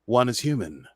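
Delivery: ordinary talk, nothing marked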